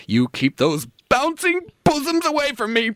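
high, hammed-up alarm; comic frightened victim; panicked protest. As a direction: fend them off in a squeaky panic. Victim fends off the Russian seductress.

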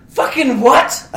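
loud, slow, high disbelief; shocked disbelief. Retold or imitated. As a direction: blurt it out in shock. Recalling his shocked reaction.

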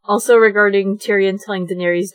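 usual speaking voice

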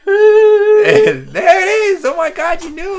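high, loud, near tears of joy; overjoyed. Real, not acted. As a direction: squeal with delight. Theme song played for her.

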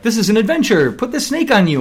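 high-pitched eager imitation; excited urging. Companion urging him into a snake adventure, mock-excited. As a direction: urge it on excitedly, mimicking her.

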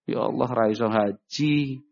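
slow, mildly mournful acted plea; sad lament. Believer unable to make hajj, mildly sad lament. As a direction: draw it out as a sorrowful sigh to God. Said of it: "harusnya sedih".

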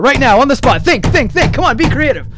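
high, loud and pushy; excited urging. Real, not acted. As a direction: hurry him on, pitch up. Pushing co-host to improvise.

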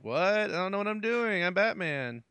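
lightly voiced, flustered Batman quote; baffled Batman. Imagining Batman stumped by unanswerable riddles, baffled. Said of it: "he would just be like".

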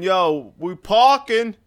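high, loud slang voice; lazy slang guy. Retold or imitated. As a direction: bark it like a street guy. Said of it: "It's like".

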